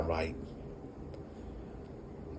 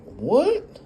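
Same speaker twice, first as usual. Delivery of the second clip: stunned what; 13 semitones higher than usual. Retold or imitated. Son walks away.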